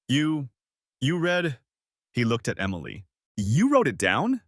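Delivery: halting disbelief rising into a question; disbelieving. A narrator's reading. Exposed lover asking about her journal, disbelieving. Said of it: "in a disbelieving whisper".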